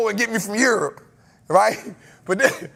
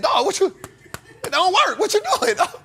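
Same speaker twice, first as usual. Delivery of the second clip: much higher, disbelieving call to a stranger; incredulous call-out; 11 semitones higher than usual. Retold or imitated. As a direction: call out in disbelief at the guy with the laptop. Retold calling out laptop man.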